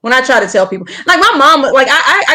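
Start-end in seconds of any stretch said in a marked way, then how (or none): none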